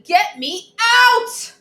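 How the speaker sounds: high, slow, loud wail; shouting. Acted. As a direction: howl it like a trapped child. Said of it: "I shouted".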